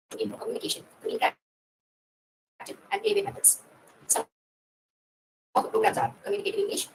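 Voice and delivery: broken-up audio on a bad call; call dropping out. Real, not acted. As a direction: voice cutting in and out. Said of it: "your voice is not clear yet".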